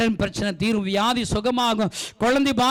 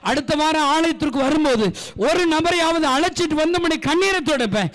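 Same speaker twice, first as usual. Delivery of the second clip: rising, fervent list of promises; preacher leading vows; building vows; 4 semitones higher than usual. Acted. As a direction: climb with each vow. Leads vows in rising fervour.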